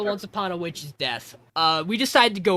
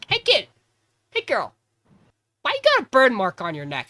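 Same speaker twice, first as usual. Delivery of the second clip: put-on high, chirpy call to a child; curious kid voice; 5 semitones higher, 5 dB louder than usual. Meg calling to a kid, bright high voice. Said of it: "she goes".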